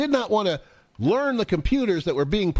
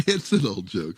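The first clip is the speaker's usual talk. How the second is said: normal talking voice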